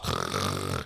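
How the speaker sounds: rough comic snore; imitated snore. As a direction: saw logs like someone fast asleep. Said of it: "sleeping, sawing logs".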